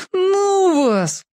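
put-on high whining kid voice; whiny little sibling. A narrator's reading. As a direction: whine it like a scared little boy. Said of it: "ноющим голосом сказал воробей".

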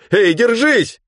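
high, loud call out; urgent call. Acted. Shore crowd shouts to the drowning man. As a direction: shout encouragement across the water.

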